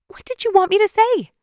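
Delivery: high, pressing question in a woman's voice; pleading woman. A narrator's reading. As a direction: ask it wide-eyed and urgent. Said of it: "she asked".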